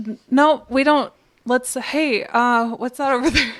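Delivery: stumble, then blurt out a distraction; flustered evasion. Acted. Uli dodging questions before running away, flustered. Flustered, makes excuse to flee.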